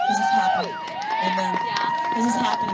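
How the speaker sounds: very high, soaring exclamation; ecstatic cry. Real, not acted. Proclaiming to the congregation that it is happening, very high and ecstatic. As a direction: lift it up like a shout of joy.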